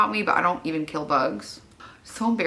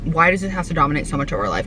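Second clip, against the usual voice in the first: usual speaking voice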